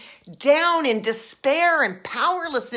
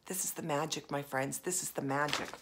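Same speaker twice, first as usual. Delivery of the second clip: soft whisper; 8 semitones lower, 10 dB quieter than usual. Real, not acted. Reveals the point softly.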